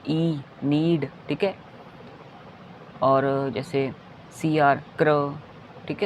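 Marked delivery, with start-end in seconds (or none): 0.1-1.1 ई drawn out long before नीड; sounding out slowly. Shown as a demonstration. Teacher showing the long ई in need. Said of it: "बड़ी ई की sound निकालेगा".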